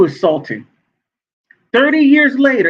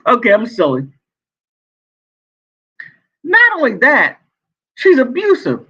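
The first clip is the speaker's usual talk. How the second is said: usual speaking voice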